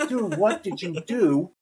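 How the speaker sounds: slow, high incredulous call; disbelieving outfielder calling over. Retold or imitated. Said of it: "It's like".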